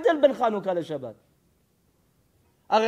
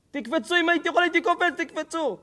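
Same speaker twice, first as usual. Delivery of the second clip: urging plea; 11 semitones higher than usual. Acted. American urges lifeguards to jump.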